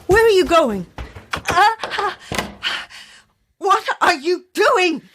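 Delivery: high, panicked questions; frightened cry. Acted. A trapped woman crying out as the cult closes in. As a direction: cry out in rising alarm.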